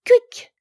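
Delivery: shrill, loud parrot squeak; a cheeky bird chirp; mocking bird squawk. The parrot answering Madeleine, mocking squawk. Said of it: "répondit Mimi ... d'un air moqueur".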